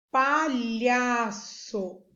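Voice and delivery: palhaço very slow, pa-lha-ço in three beats; syllable by syllable. Shown as a demonstration. Teacher naming the picture to spell, palhaço syllable by syllable. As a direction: stretch palhaço out, each syllable on its own. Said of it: "tem três sílabas".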